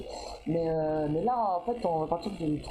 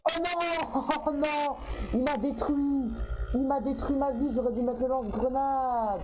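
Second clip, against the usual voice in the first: high, drawn-out wail of defeat; whining gamer's despair, easing down at the end; wailing dismay; 4 semitones higher, slower than usual. Real, not acted. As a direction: lament the loss, stretching the words. Just killed in the game.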